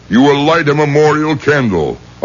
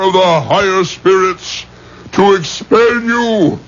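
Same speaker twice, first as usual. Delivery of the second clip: higher, rising ritual command, slowing at the end; commanding exorcist; 5 semitones higher than usual. Acted. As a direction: order the spirit out with mounting force. Rabbi escalates the exorcism.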